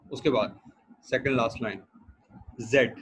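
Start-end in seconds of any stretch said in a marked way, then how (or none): none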